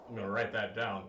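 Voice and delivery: usual speaking voice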